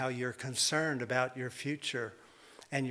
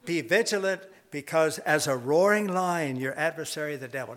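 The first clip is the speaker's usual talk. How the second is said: preacher takes up the verse again; scripture reading; 2 semitones higher, 5 dB louder than usual. Acted. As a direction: proclaim the warning.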